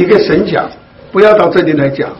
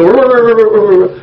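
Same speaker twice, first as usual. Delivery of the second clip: long rolling tongue trill, pitched up; rapid trilled gibberish; imitated tongues babble; 4 semitones higher, 3 dB louder than usual. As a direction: mimic someone showing off learned tongues. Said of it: "他就到聚会的时候就".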